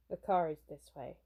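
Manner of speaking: lightly voiced low-key bodyguard; stoic bodyguard. Bodyguard directing pop star to the car, lightly stoic.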